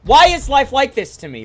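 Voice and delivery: high, loud screamed outburst; imitated distraught screaming. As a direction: scream it out like a streamer breaking down. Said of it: "they're crying and they're screaming".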